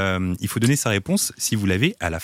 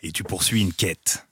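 low, slow dragon voice; slow, soft, wise dragon; 4 semitones lower, 5 dB quieter, slower than usual. Acted. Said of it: "je fasse une petite voix".

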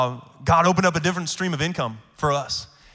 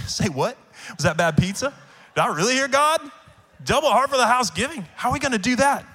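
incredulous; 9 semitones higher, 5 dB louder than usual. Retold or imitated. Stunned reply to God's request.

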